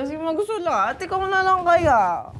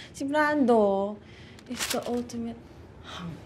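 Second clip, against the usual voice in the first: dreamy; 3 semitones lower, 7 dB quieter than usual. Real, not acted. [name] swoons over Brando, dreamy soft voice. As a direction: sigh over him. Dreamily describes fictional hunk.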